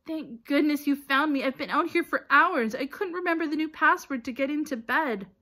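high, loud, flustered; worked-up, high-pitched gush; relieved outburst. A narrator's reading. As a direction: pour it out with anxious relief. Neville relieved at being found.

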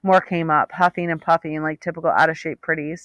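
plain reading voice

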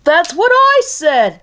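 huffy. Acted. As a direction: huff it like a caught-out ruler. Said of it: "the king huffed".

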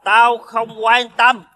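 very slow, high-pitched refusal; drunken insistence. Acted. Drunk creditor rejecting a delay, slow and insistent. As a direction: stretch out every word, refusing to listen.

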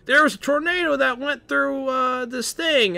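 high, put-on yokel farmer voice; farmer quote. Farmer telling the crew about a tornado, high put-on voice. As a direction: squeak it out like a nervous farmer. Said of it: "some farmer was like".

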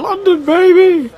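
a slow, high, loud sitcom catchphrase; Friends quote. Retold or imitated. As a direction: yell it like an overexcited tourist on TV. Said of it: "the Friends episode where they go to London".

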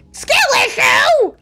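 loud, high, drawn-out shout; mock outraged yell. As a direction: burst out yelling in mock outrage. Mock rant breaks into a yell.

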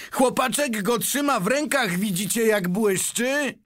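very high, thrilled calling; excited cry. A narrator's reading. Onlooker shows the crowd the shining coupon, excited cry. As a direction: exclaim to the whole crowd.

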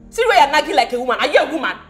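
loud, fast and laughing, she jeers at him; a wife loudly mocking her nagging husband; mocking loud taunt. Wife mocks husband in quarrel.

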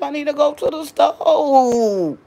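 slow, high, wailing fake cry; hammed-up sobbing plea, stretched out; mock crying whine. Scared of wasp, whines.

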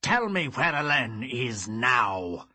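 loud, slow menacing demand; cold fury. Acted. Father demands, snarling.

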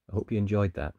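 ordinary delivery

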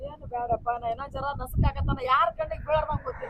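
wife telling the neighbour how she was thrown out; tearful lament. Acted. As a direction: sob out the tale quietly. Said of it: "ಅಳ್ಬೇಡ, ಅಳ್ಬೇಡ".

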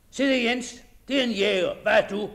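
loud, scornful master ribbing the servant; loud jeering landowner; mocking master. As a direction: bark it out as the master teasing Jens. Master mocking Jens's shooting.

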